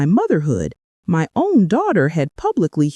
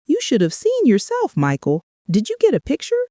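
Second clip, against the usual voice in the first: mocking daughter; 7 semitones higher than usual. Daughter mocking amid laughter.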